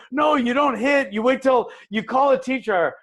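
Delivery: high-pitched mock teacher telling a child off; scolding teacher.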